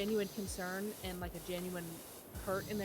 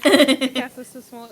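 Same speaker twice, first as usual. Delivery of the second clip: loud read-out; 4 semitones higher, 20 dB louder, slower than usual. Real, not acted. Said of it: "reading chat aloud".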